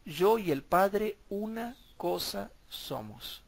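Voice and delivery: slow, weighty quote of Jesus; drawn-out solemn quote; slow quoted scripture. Acted. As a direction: lean on each word of the verse. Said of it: "Él dijo clarito".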